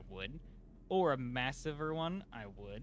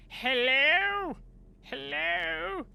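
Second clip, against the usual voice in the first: much higher, loud drawn-out "Hello?"; high exasperated call; 10 dB louder, much slower than usual. Real, not acted. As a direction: plead with the dead machine. Calling to unresponsive machine.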